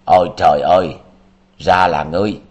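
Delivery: narrator's acted exclamation of shocked recognition; startled cry. A narrator's reading. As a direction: blurt it out loudly in surprise. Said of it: "buột miệng la lớn".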